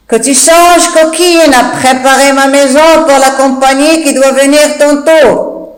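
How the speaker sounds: irritated master scolding his maid; Monsieur Jourdain. Acted. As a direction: bristle at the cheeky servant.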